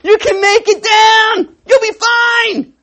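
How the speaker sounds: high, loud, slow hyped-up cheering; cheering crowd. Retold or imitated. Pastor voicing guys cheering a risky jump, a loud high slow cheer. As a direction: shout them on like buddies egging a friend off a cliff. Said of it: "this group of guys standing behind him going".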